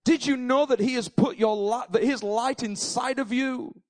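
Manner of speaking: fervent pulpit preaching; full-force preaching. Acted. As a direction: preach it out with fire to the congregation.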